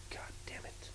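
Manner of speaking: breathed under the breath, no voice; whispered curse. Real, not acted. Fan closing his rant, whispered curse. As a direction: let it out as a spent whisper.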